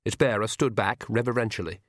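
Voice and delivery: usual speaking voice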